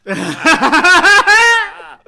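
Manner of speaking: loud, high shrieking laughter; howling laugh. Real, not acted. Host cracking up at the parrot joke, screaming with laughter. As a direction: lose it completely at the punchline.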